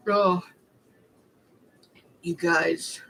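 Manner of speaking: ordinary talk, nothing marked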